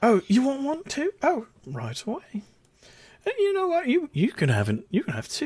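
high, soft, fussing voice; delighted hostess. Acted. Gwen offering a second quiche, high soft cooing. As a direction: coo softly, starting high and settling. Gwen serving a hungry customer.

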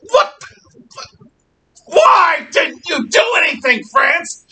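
high, loud, cracking cry of outrage; outraged disbelief. Retold or imitated. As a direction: yell at France in furious disbelief.

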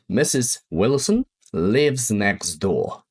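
slow model sentence. Shown as a demonstration. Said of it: "Jeszcze jedno".

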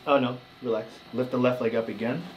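ordinary delivery

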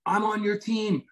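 high, gushing mock excitement; imitating a thrilled fan. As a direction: play the starstruck fan meeting him. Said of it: "She goes".

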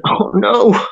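high-pitched mock dismay, lightly acted. Extra retelling losing his line to the late actor, mock dismay. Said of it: "And I'm going".

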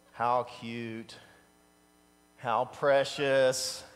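usual speaking voice

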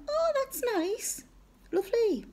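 high, drawn-out cooing niceties; oblivious, cheery approval. Retold or imitated. Listener nodding along to an uncle she cannot understand, obliviously cheery. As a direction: coo it sweetly high, with no idea what was said. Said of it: "totally oblivious to what he's telling me".

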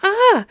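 high, loud frightened "Ah!"; narrator's acted startled scream; frightened cry. A narrator's reading. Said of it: "cried the four".

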